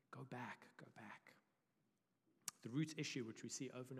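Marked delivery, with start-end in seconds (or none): 0.1-1.3 unvoiced, tempting whisper. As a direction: breathe it like a lure.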